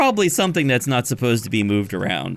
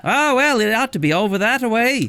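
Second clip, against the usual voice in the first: higher, louder, airy barkeep voice; 11 semitones higher, 3 dB louder than usual. Acted. Genasi barkeep waves the party off, high voice. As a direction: point them off vaguely.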